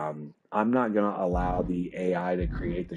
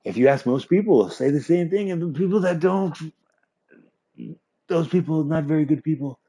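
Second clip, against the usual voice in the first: high, smug villain voice jumping much higher and louder on peaks; mock JP voice; 7 semitones higher, 3 dB louder than usual. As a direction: ham up a gloating rule-abusing boss. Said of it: "He's literally".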